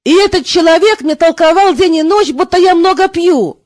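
usual speaking voice

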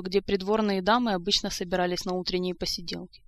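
ordinary delivery

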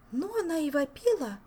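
put-on a young lady shaking her head in disbelief; lightly voiced Olga. A narrator's reading. Olga remarking on the viscountess's screaming. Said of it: "покачала головой Ольга".

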